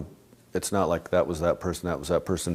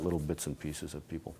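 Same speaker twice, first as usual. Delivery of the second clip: usual speaking voice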